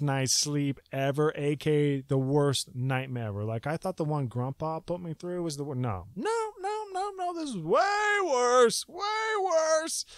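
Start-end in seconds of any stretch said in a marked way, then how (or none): 6.1-10.0 high, crying shout of denial; anguished no. Retold or imitated. Recalling the worst nightmare, an anguished crying shout. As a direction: wail it like the nightmare is back.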